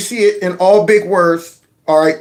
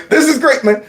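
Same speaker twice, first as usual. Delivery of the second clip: normal talking voice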